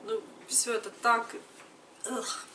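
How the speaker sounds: ordinary delivery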